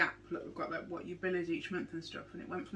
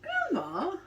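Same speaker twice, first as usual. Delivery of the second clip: indignant; 12 semitones higher than usual. Real, not acted. Echoes being called grandma.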